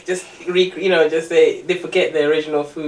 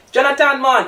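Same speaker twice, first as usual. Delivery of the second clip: bad Jamaican accent; 11 semitones higher, 7 dB louder than usual. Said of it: "that's a bad Jamaican accent".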